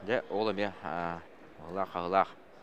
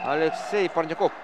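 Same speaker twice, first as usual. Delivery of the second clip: name called out louder and higher; excited name call; 6 semitones higher, 6 dB louder than usual. Real, not acted. Commentator shouts the player's name in loud, high excitement. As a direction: hail the player as he scores.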